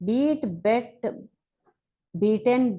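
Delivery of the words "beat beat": long ee in "బీట్", short e in "బెట్"; "బీట్" higher with long ee, then short "బెట్"; vowel contrast. Shown as a demonstration. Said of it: "pronunciation మాత్రం బెట్ అని రావాలి".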